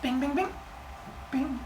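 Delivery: playful lyre-plucking bing; plucking noise. Retold or imitated. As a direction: strum an imaginary lyre with your voice. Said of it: "He was like".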